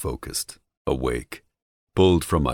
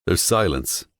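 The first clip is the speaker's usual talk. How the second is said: ordinary delivery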